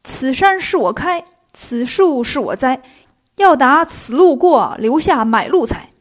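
slow, chanted highwayman's challenge; drawn-out, hammed-up hold-up call; loud robber's cry. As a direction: declaim it line by line like a bandit gang. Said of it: "大声叫道".

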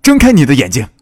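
loud, high-pitched urgent cry; urgent shout. A narrator's reading. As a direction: shout to rouse someone fading away. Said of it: "又一次地喊道". (急切地大声喊叫)

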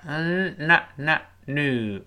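normal talking voice

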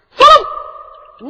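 high, loud shout of the name; calling out. Acted. Captive Liu Xiu calls on Su Long, sharp shout. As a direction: fling the name out as a challenge.

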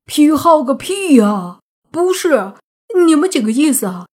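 high, loud, exasperated denial; miserable, wronged protest. A narrator's reading. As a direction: protest your innocence in anguished disbelief. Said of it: "泥巴苦着脸说道". (又急又委屈地高声喊冤)

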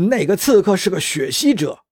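urgent high-pitched yell; angry shout. A narrator's reading. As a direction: call it out in anger. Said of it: "驾驶员愤怒地喊道".

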